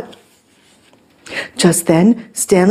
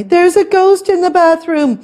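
high-pitched storybook alarm; panicked call; 10 semitones higher, 3 dB louder than usual. A narrator's reading. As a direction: call out in a fright. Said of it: "called Stanley".

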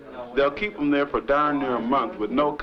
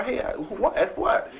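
confused; 11 semitones higher, slower than usual. Retold or imitated. Confused in firefight.

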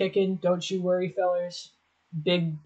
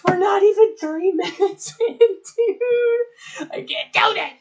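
cringing whine; 14 semitones higher than usual. Real, not acted. Rewatching old clips, cringing in a high whine. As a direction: squeal it out in real embarrassment. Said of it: "this sucks".

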